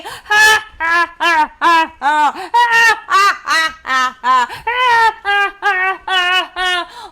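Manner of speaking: loud, hammed-up wolf cackle; villain's laugh. Wolf laughs at the pig's refusal, loud stagy laugh. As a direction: laugh in big rolling bursts of ha-ha.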